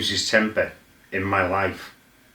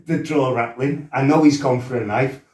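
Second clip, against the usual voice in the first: ordinary talk, nothing marked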